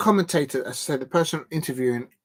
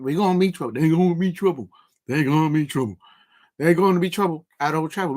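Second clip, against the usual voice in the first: put-on a self-confessed weak American accent; hammed-up, shaky American accent; American accent attempt. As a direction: try an American accent and half fail. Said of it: "I can't do the American accent".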